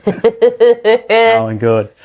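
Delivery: slow, high Geordie voice; drawn-out Geordie; Geordie accent. Shown as a demonstration. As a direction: show off the Geordie practice. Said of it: "practice going for the Geordie land".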